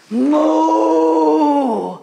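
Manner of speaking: long high loud moo; calf moo. Shown as a demonstration. Teacher leading kids' warm-up, big loud calf moo. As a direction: moo up at the moon. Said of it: "be a moon calf and lift up".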